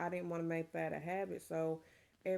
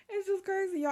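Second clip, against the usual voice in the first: laughing; 13 semitones higher, 9 dB louder, faster than usual. Real, not acted. Laughing about having two kids.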